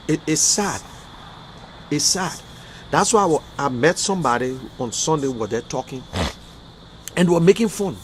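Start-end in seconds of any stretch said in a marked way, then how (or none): 0.1-2.4 quiet, lower repeated sad. Real, not acted. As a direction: let the sadness pull the voice down. Emotional rant about suffering.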